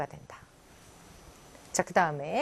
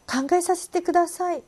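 noncommittal reply; 9 semitones higher, much slower than usual. Acted. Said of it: "판단의 보류 또는 거절".

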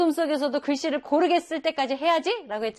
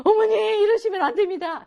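high, loud overacted plea; hammed-up pleading son; 10 semitones higher, 7 dB louder than usual. Shown as a demonstration. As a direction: overdo a desperate son. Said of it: "그게 아니라". (과장된 애원)

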